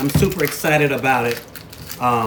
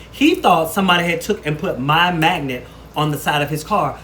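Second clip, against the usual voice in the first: ordinary talk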